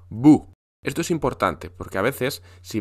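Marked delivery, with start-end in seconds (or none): 0.1-0.5 boo. Pretend. Acting out a sudden scare.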